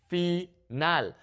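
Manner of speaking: Final split into fee, NAHL; Spanish "Final" in two separate syllables. Shown as a demonstration. Teacher breaks it up, Final in Spanish syllables. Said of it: "break it up into syllables".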